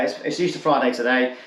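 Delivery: normal talking voice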